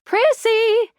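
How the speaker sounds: high-pitched call of a name. Acted. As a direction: call out to Prissy, pitched well up. Calls out to a character.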